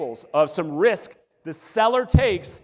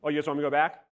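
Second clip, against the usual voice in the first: ordinary talk, nothing marked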